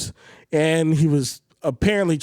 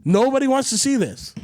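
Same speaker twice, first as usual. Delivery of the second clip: angry retold rant; 8 semitones higher than usual. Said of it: "He was like".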